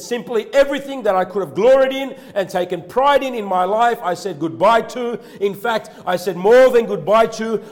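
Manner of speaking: high and loud, preaching Paul's words; full-force preaching. Acted. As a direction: lift the voice and preach it out.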